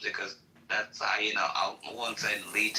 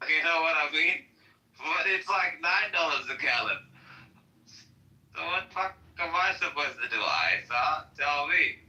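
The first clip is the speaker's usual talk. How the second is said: exasperated rant; 4 semitones higher, 3 dB louder than usual. Real, not acted. Ranting about nine-dollar gas.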